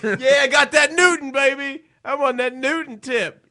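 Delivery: high, loud hype; loud, high mock swagger; hyped street hustler. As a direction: brag it loudly like a T-shirt hawker. Playing a slogan T-shirt seller.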